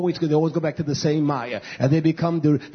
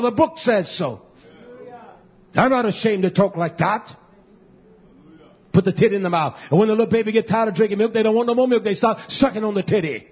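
full-force preaching; 6 semitones higher than usual. Acted. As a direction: drive it home from the pulpit.

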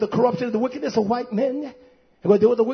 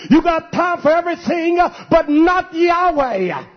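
loud, high preaching at full force; impassioned preaching; 3 semitones higher, 4 dB louder than usual. Real, not acted. Preacher rebuking the congregation for neglecting Scripture, impassioned. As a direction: drive it home like a fired-up preacher.

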